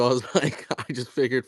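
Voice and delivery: ordinary delivery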